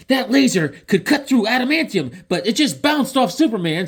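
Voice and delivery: put-on high, awed little boy; kid voice. Franklin marveling that the laser bounced off, high kid voice. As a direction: gasp it out like an amazed kid.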